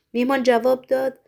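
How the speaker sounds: usual speaking voice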